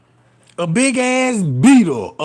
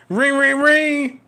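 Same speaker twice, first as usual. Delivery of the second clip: sing-song ring ring; phone ring imitation; 5 semitones higher, slower than usual. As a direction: mimic a phone ringing. Mimics phone ringing.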